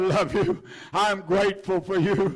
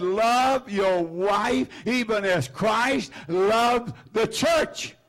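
preached scripture shout; much slower than usual. Real, not acted. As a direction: thunder the verse out slowly. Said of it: "The Bible said".

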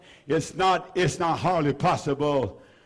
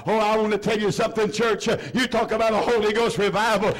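rising, driving revival preaching; preaching at full force; 5 semitones higher than usual. Acted. Preacher rallying the church about revival, full-force preaching. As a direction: fire up the church toward revival. Revival call, raised pitch.

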